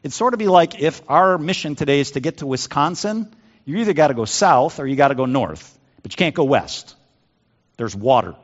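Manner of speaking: ordinary talk, nothing marked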